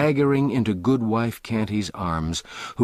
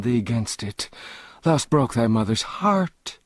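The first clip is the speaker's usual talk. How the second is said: tearful plea; 6 semitones higher than usual. A narrator's reading. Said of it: "through her rising tears".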